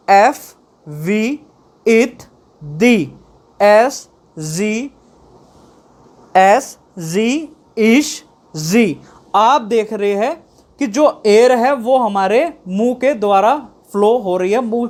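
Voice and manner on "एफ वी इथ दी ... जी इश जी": each consonant held out slowly, one by one; consonant sounds demo. Shown as a demonstration. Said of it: "एक उंगली को यहाँ रखिए और जब बोलिए".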